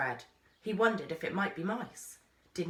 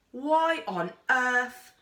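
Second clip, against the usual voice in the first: slow, high and loud disbelief; incredulous; 6 semitones higher, 6 dB louder, much slower than usual. A narrator's reading. Girl aghast that Fred wants to try, slow incredulous. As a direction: stretch out the outrage. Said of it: "That shouldn't be a shocking position".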